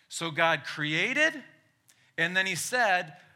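loud, high preacher reading scripture; booming biblical narration. Acted. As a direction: proclaim it like from the pulpit. Reads Genesis aloud.

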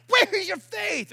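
high, slow, indignant cry; acted exasperated demand; stern outburst. Shown as a demonstration. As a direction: exclaim it in loud rebuke. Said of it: "I'm not sure how he said it".

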